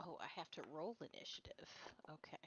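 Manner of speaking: whisper. Real, not acted. Whispered to self.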